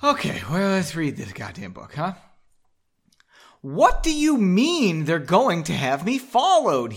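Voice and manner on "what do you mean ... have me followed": louder, higher indignant question; a half-yelled, drawn-out demand; mild yell. A narrator's reading. Henry demands to know why he'll be followed, mildly yelling. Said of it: "he yelled".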